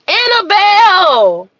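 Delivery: loud, drawn-out call of Annabelle. Real, not acted. Scolding her pet Annabelle, a loud slow call. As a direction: call her name across the room. Calling out to the dog.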